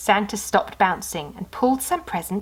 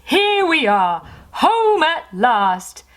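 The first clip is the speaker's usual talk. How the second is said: higher, louder, slow; happy arrival; 7 semitones higher, 4 dB louder, slower than usual. Acted. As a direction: celebrate getting home. Santa arrives home.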